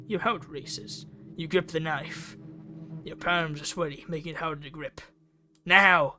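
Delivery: normal talking voice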